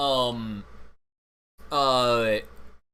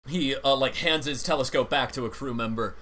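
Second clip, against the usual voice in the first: normal talking voice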